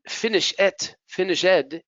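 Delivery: "finish ed" as two separate words; learner's error, "finish ed" sounding like "finish it"; mispronounced -ed ending. Shown as a demonstration. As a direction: add a full extra syllable after "finish". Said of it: "if you pronounce".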